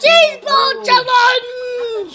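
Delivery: slow, drawn-out announcer shout. Acted. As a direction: call out the title like a game show host. Announcing the challenge title.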